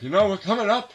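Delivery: put-on hammy old retiree making small talk; old husband voice. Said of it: "It's like".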